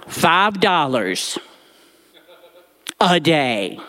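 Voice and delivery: slowly, with a laugh on "a day"; slow emphatic echo. Retold or imitated. Boy repeating his small allowance, slow and amused. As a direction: let "Five dollars" land heavy and slow.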